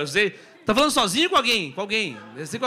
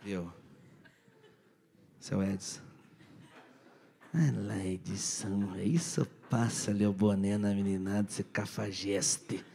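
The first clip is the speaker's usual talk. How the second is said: much lower, slow teasing voice; mock low voice; 12 semitones lower, 6 dB quieter, slower than usual. Comedian teasing Edson: low, slow mock voice. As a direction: drag it out low to rib him.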